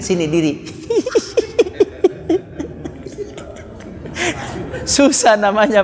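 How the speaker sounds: laughing high through the words; amused laugh. Real, not acted. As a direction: break into laughter at the joke. Joking about long names.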